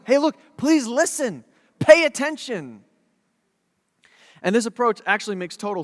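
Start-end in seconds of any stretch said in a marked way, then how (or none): 0.1-2.9 higher, urgent plea for attention; emphatic, imploring appeal; pleading father. Retold or imitated. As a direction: plead with them to listen, stretching the last words. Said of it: "why is he being so emphatic".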